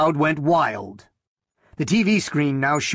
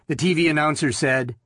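ordinary delivery, nothing marked

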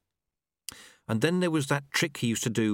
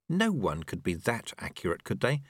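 usual speaking voice

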